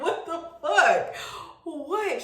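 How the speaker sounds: high, slow-stretched disbelief; baffled shock. Retold or imitated. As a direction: act out being dumbfounded. Acting out shock at second interruption.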